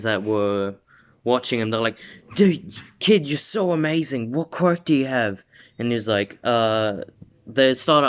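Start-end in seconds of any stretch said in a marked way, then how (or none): 2.3-5.4 higher-pitched, acted gushing; excited admiration. Reporters and civilians question the kid, excited admiration. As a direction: play a thrilled crowd firing a question.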